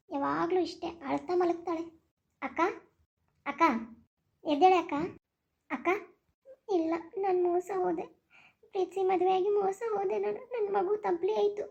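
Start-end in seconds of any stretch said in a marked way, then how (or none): 2.4-5.9 calling out. Acted. Waking sleeping sister, calling. 6.7-11.6 weep out the betrayal, dragging the last words; tearful lament. Acted. Deserted sister tells Gauri of her betrayal, crying. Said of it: "ಎದಕ್ ಅಳ್ತಿದೀಯಾ?".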